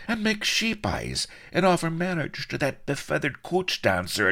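high-pitched Irish-flavoured reproach; needling, high-voiced telling-off; scolding tirade. A narrator's reading. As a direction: rattle off the accusations mockingly. Blood scolds the captain for philandering.

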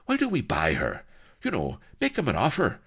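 urgent, wheedling hiss; hissed suggestion. A narrator's reading. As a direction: coax hurriedly, rising high at the end. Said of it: "hissed the colonel".

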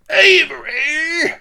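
huge high, slow, loud roar of a name; apoplectic bawl. Acted. Admiral roaring his enemy's name, apoplectic bawl. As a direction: explode with rage on the name. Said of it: "bawled Rooke apoplectically".

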